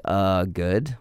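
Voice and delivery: imitating a friend who answers without interest; lightly voiced unimpressed friend; Evan's bored answer. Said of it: "He'd be like".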